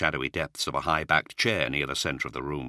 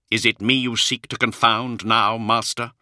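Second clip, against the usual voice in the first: accusing; 5 semitones higher, 5 dB louder than usual. Acted. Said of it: "His expression turned sombre".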